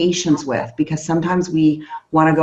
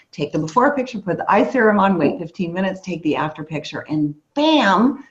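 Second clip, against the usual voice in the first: ordinary talk, nothing marked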